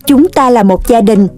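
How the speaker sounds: low, gentle lecturing mum; a mother patiently teaching her son; mother lecturing son on family, low and gentle; mother's voice. Acted.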